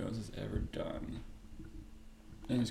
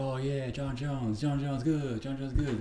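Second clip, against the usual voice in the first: mock crowd cheer; 3 semitones higher, 6 dB louder than usual. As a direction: chant his name like a dumb fan crowd. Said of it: "And everyone's like".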